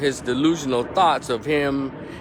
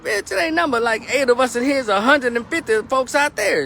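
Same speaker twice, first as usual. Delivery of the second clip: much higher and louder, incredulous scolding; exasperated; 13 semitones higher, 5 dB louder than usual. Pretend. Narrator imagining yelling at the wife, high and loud. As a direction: scold her like she lost her mind. Imagining his own reply to her.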